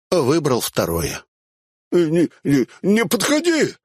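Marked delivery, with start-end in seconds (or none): 1.9-3.7 panicked yell, climbing much higher; high frightened shout. Acted. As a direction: scream at him to stay back. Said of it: "заорал он".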